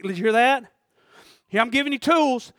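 ordinary delivery

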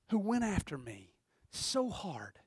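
hushed unvoiced whisper, slow. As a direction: whisper it to the congregation. Hushed sermon close.

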